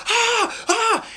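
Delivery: high-pitched acted shrieks of agony; mock burning screams. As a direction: shriek as if bursting into flame, hammed-up. Creusa burning to death.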